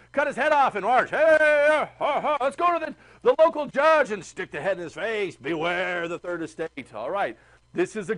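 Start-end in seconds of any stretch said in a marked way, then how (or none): 0.1-5.4 loud, rough revolutionary chant with hey hey; rally the crowd to march on the judge; rowdy mob shout. Retold or imitated. Teacher acting the angry Bastille mob, shouting loud. 5.4-6.9 ominous warning. Retold or imitated. Mob's threat, slow and ominous.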